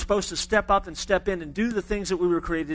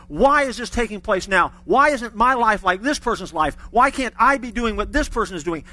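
louder, driving sermon cadence; preaching; 5 dB louder than usual. Acted. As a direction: press the point on the congregation. Preaching builds in force.

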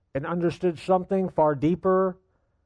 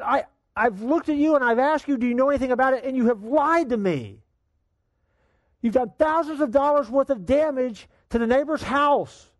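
angry father; 9 semitones higher than usual. Retold or imitated. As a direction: tear into him for lying. Father scolding lying son.

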